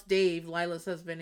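ordinary talk

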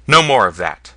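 loud, cutting order to stop; sharp rebuke. Acted. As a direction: shut the singer down hard and loud. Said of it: "stopped him with a sharp".

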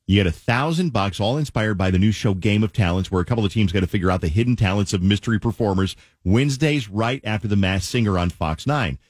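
upbeat DJ promo read; radio contest promo. Acted. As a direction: sell the contest, big radio voice.

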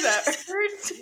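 usual speaking voice

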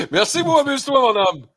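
high, loud, laughing through the thanks. Real, not acted. Host thanks his friend on air while still laughing, high and loud. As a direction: giggle your goodbye to the buddy.

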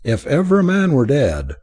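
ordinary delivery, nothing marked

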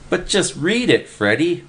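high, pleading shriek at Freddy. A narrator's reading. Willie begs Freddy to read the paper, high shriek. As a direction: squeal it out, begging him to read. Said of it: "Willie shrieked".

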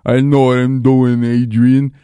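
put-on slow, slurred punch-drunk boxer; punch-drunk Rocky. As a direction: lightly imitate a dazed boxer, slowly. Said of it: "like a punch-drunk Rocky in Rocky Four".

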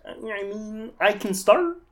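high, thin Kermit impression; Kermit the Frog voice. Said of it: "in Kermit's voice".

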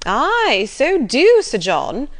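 high, loud, egging him on; excited cry. A narrator's reading. Mrs Jennings urging Sir John to go after him, excited. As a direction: cheer the idea on with a leaping pitch. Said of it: "cried Missus Jennings".

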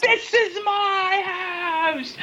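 high, long drawn-out scream; wrestler's shrieked catchphrase, very high and slow; Paige. Acted. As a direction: scream the catchphrase, stretching every word. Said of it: "something she would always scream".

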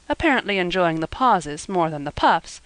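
plain reading voice

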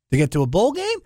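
ordinary talk, nothing marked

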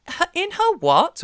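put-on high, loud, slow child's "in her what?"; mock innocent shock. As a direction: play a little kid baffled by what he hears.

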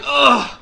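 loud mock groan. Teller reliving face-plant into dirt, loud groan. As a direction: grunt like you just hit the dirt. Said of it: "I'm like".

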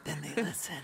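whispering. Real, not acted. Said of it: "I have to whisper".

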